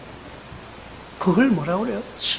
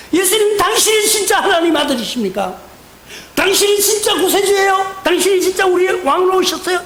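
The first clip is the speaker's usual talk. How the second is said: sarcastic taunt; 7 semitones higher than usual. Retold or imitated. Robbers sneering at Jesus on the cross, high sarcastic jeer. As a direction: needle him with fake doubt. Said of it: "이러면서 빈정거리는 거예요".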